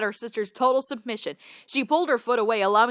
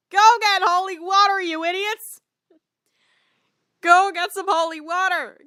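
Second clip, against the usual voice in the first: higher, exasperated jab; mock scolding; 7 semitones higher than usual. Real, not acted. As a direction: scold them jokingly.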